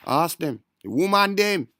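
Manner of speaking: loud, high, hyped-up call; excited shout. Real, not acted. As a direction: shout it out with glee. Excited shout about women.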